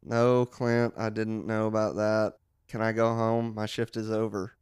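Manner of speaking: low, weary lightly voiced coworker; a hint of a fed-up clerk; bored coworker voice. Skit coworker Mark, low and bored.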